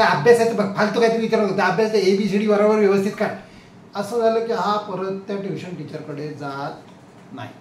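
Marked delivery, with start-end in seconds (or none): 0.0-3.3 loud, stern telling-off; teacher scolding. Retold or imitated. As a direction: shut the question down sharply. (शिक्षकाचे कडक दटावणे)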